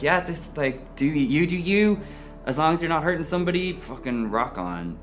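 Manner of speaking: breezy, high mock of cheering mates; carefree friends. Voicing supportive friends.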